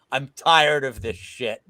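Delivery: exasperated. Real, not acted. Fed up with co-main fights.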